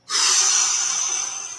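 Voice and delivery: long breathy exhale; empty the lungs with a big blow; blowing out hard. Shown as a demonstration. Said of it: "blow out".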